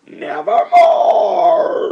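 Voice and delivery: very high, loud, slow shrieking caw; raven's final cry. Acted. Raven answering the poet with a slow, shrieking caw. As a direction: scream it out like a cawing raven. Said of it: "Quoth the raven".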